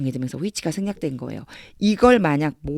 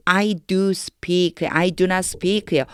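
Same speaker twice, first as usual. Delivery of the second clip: slow reading; much slower than usual. Shown as a demonstration. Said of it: "남는 게 누구냐면".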